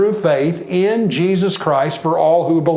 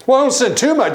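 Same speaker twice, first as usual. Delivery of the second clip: mock excuse; 10 semitones higher, 3 dB louder, faster than usual. Mock excuse, pitch jump.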